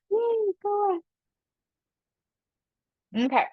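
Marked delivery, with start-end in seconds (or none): 0.1-1.0 high, bright little cheer; cheery celebration. Real, not acted. Teacher cheering the class for solving the problem, playful high cheer.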